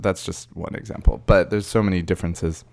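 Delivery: usual speaking voice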